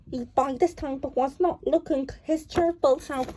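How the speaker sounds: ordinary delivery, nothing marked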